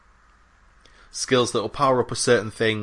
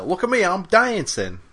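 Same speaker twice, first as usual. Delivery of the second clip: high-pitched mock robot boast; a lighter go at the excitable robot; raised-pitch imitation of a show-off robot; Claptrap; 9 semitones higher than usual. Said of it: "That's what claptraps say".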